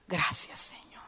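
breathy whispered thanks after a sigh; whispered prayer. Retold or imitated. Pastor whispering thanks to God in the shower, hushed. Retold prayer at coffee maker.

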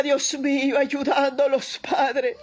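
ordinary delivery

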